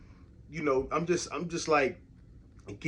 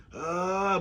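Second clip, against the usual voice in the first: drawn-out, higher "uh"; imitated strained utterance; 4 semitones higher than usual. Retelling a dying cousin's only sound, imitated strained groan. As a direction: imitate a cousin struggling to get words out. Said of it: "all he could get out was just a".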